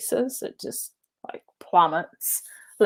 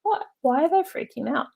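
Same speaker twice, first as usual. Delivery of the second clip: higher, quick puzzled question; mock bewilderment; 9 semitones higher, faster than usual. Friends in their bubble watching panicked passengers, mock bewilderment. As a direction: act baffled by everyone else's panic. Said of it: "looking at everyone going".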